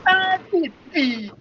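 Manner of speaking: slow, high, loud quoted line; movie quote. Retold or imitated. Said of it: "you got that reference".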